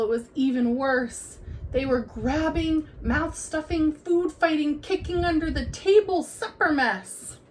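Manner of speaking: usual speaking voice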